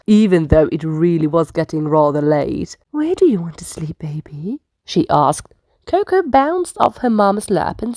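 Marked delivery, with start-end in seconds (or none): 2.9-4.6 soft, quick hushed whisper; whispered question. Acted. Mother asks her little one at bedtime, whispering. Mama sloth asks late at night.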